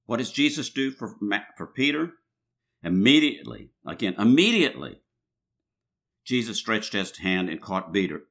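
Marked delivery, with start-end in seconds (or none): none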